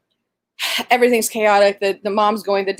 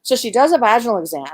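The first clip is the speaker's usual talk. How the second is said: ordinary delivery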